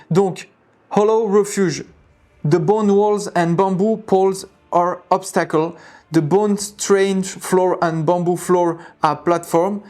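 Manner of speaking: slow English reading in a French accent; real, clumsy French-accented English; thick French accent. Real, not acted. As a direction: read English haltingly with a strong French accent. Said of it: "Désolé pour mon accent de merde".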